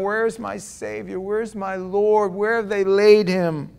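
slow, pleading search for her Lord; understated weeping voice of Mary; mild crying plea. Retold or imitated. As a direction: lament like a grieving woman, slowly. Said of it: "And she's crying".

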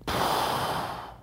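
long breathy exhale, giving up; defeated sigh. Retold or imitated. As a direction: let out a weary sigh. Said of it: "And I'm just like".

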